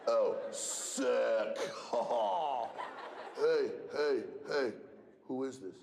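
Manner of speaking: put-on low, mumbly teen boy with laughs; mock a goofy eighth grader fumbling a phone call; eighth grade boy on the phone. Mimicking boys who call him on the phone, low and laughing. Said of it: "They go".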